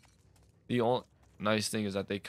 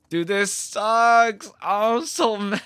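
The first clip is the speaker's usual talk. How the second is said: high, slow, stretched-out complaint with a laugh; exasperated whine; 11 semitones higher, 6 dB louder, slower than usual. Real, not acted. Streamer fed up with hiding zombies, high exasperated whine. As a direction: whine it out to the chat. Frustrated at enemy type.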